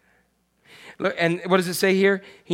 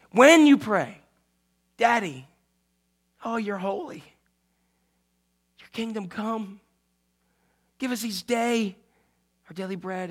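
tender prayer; 4 semitones higher than usual. Acted. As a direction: pray it gently, like talking to your dad. Jesus reciting the Lord's prayer.

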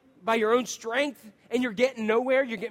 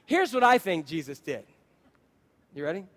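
ordinary talk

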